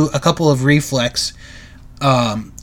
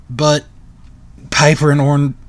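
usual speaking voice

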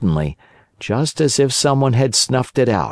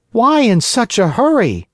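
put-on high, surprised marionette boy; puppet boy voice; 13 semitones higher, 3 dB louder than usual. A narrator's reading. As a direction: ask it as a wooden puppet boy. Pinocchio asks innkeeper.

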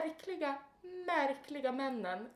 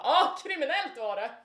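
loud, unpleasant parroting; nasty mocking echo; 3 semitones higher, 8 dB louder than usual. Repeating a man's compliment so he'll leave her alone, nasty mockery. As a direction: throw his words back at him nastily. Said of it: "upprepar det han säger på ett jätteotrevligt sätt".

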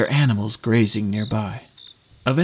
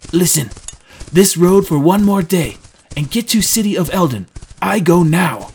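loud, forceful parting orders; curt instructions; 4 semitones higher, 7 dB louder than usual. Acted. Trendon leaves Drew with directions, loud and curt. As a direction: give directions firmly, raised and impatient.

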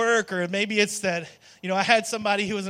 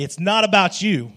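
it's not about you, said louder; 4 dB louder than usual. Shown as a demonstration. Congregation told to face neighbors, says it louder. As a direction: point it at your neighbor with relish. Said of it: "louder than".

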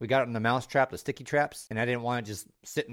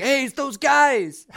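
excited fan; 9 dB louder, slower than usual. Retold or imitated. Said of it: "it's like".

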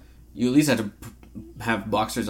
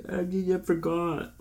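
slow, high, soft mimicry; mock a friend's feeble excuse, dragged out; imitated excuse; 6 semitones higher, 7 dB quieter, slower than usual. Imitating the absent friend listening to this, slow high mock excuse. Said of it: "he'll be like".

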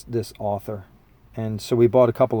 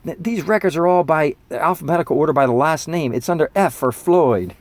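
higher voice, correcting a friend; exasperated kid; 5 semitones higher, 4 dB louder than usual. Retold or imitated. Kid correcting friend about record order, exasperated. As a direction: set the dumb friend straight, teen style. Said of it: "they're going".

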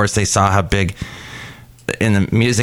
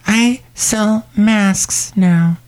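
very slow high shop lady; Shelley Shuhart voice; 10 semitones higher, much slower than usual. Acted. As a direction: drag it out high and sweet. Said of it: "It's Shelley Shuhart".

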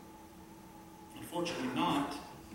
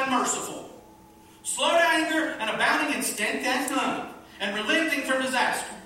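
loud, high, exasperated plea to God; complaining prayer; 7 semitones higher, 6 dB louder than usual. Acted. Jonah complaining to God in prayer, loud and exasperated. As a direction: throw the complaint right at God. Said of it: "he prayed to the Lord and said".

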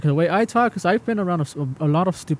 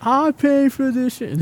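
mock outraged customer; 9 semitones higher, slower than usual.

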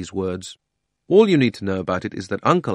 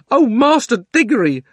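high, loud, thrilled maid's cry; excited housemaid; 8 dB louder than usual. Acted. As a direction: call out to the young master excitedly. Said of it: "was really having a wonderful day".

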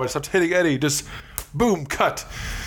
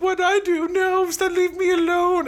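very high, pleading victim voice; hammed-up, squeaky protest; Pennywise. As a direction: plead like a picked-on little creature. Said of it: "And Pennywise is like".

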